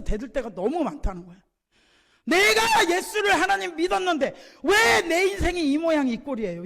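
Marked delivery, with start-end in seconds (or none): 2.2-6.6 high, loud protesting shout; hammed-up indignant complaint, easing at the end; railing at God. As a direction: imitate a believer yelling back at heaven. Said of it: "하나님 앞에 막 아글아글 쓰면서 대드는".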